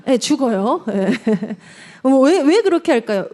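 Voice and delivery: normal talking voice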